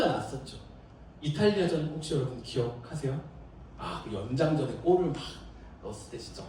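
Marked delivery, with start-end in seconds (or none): none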